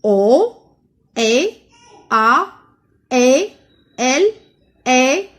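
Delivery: loud single letters, a pause after each; evenly spaced, emphatic letter-by-letter reading; reading letters aloud. Shown as a demonstration. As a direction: call out each letter for the class. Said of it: "on va lire ensemble".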